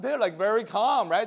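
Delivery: ordinary delivery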